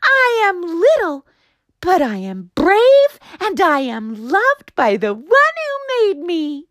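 very high, loud joyful cry; joyful proclamation. Acted. Juniper proclaiming he is brave and loved, high and loud. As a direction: declare it bravely with joy. Continues his proud shout.

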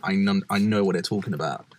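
ordinary talk, nothing marked

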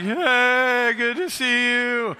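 slow, drawn-out mock-drowsy hello; half-awake mock greeting; sleepy greeting. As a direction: imitate an under-slept congregation dragging out a greeting. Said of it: "You must have got some sleep".

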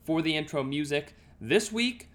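loud, upbeat host intro; podcast intro. Acted. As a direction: welcome listeners with energy.

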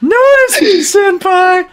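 loud squealing anime fangirl; overacted anime dub. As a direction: cry it out like a lovesick anime girl. Repeating the anime plea loudly.

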